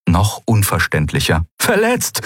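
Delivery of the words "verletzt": very high urgent fragment; high-pitched cry on a radio; distress caller. A narrator's reading. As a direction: let one high word through the static. Fragment of broken-up distress call.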